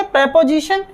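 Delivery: "प्रीपोजिशन" as prep-o-zishan, rising at the end; prep, never pree, in "प्रीपोजिशन"; correct pronunciation. Shown as a demonstration. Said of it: "सही तरीके से pronounce". ("प्रीपोजिशन" को प्रेपोजिशन, चढ़ते स्वर में)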